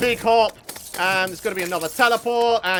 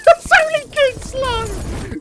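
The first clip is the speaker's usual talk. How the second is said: higher exasperated shout; 6 dB louder, slower than usual. Real, not acted. Exasperated at boss.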